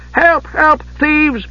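high-pitched cries for help. A narrator's reading. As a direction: cry out in a high, alarmed voice. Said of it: "He raised his voice".

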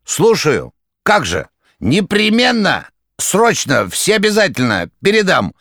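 high, loud, staccato bursts; clipped rattling bursts. A narrator's reading. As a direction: bang out each word separately. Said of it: "отрывисто стукала трубка".

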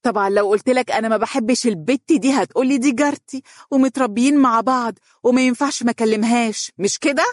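voiced as a doubting girlfriend; suspicious. A narrator's reading. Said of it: "وتنظر إليه بشك قائلة".

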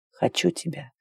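very soft, husky confession; husky murmur. A narrator's reading. Ventura tells Claire his desire, very soft husky murmur. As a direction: drop right down, hoarse and hushed. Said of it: "хрипло произнёс Вентура".

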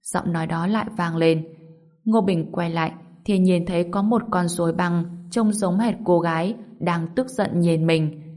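ordinary delivery, nothing marked

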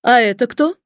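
lightly voiced thin-voiced boss; a hint of a piercing little voice. A narrator's reading. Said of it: "Голос у него был тонкий и пронзительный".

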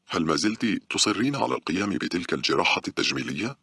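plain reading voice

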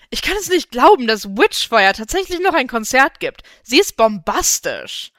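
loud, high-pitched gushing; thrilled, loud fan outburst; excited fangirl. A narrator's reading. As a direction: burst out loudly in giddy disbelief, high at the start. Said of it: "aufgeregt im Raum herum und klang verdächtig nach Stephanie".

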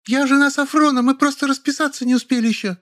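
very high, heated claim; shrill defence. A narrator's reading. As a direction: insist shrilly. Woman defends herself shrilly. (визгливое оправдание)

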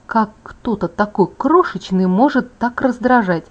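plain reading voice

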